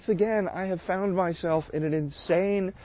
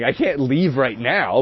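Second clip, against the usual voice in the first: usual speaking voice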